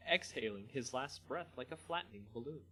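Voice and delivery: ordinary delivery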